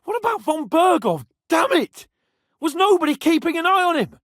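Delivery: high, loud and urgent; alarmed shouting. Acted. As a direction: shout it out in sudden panic. Alarm at escaped Von Bergov.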